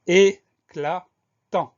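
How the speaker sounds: very slow, each syllable of "éclatant" held and set apart; syllable-by-syllable dictation. Shown as a demonstration. Teacher presenting the next spelling word, slowly syllable by syllable.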